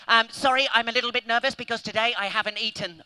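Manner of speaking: usual speaking voice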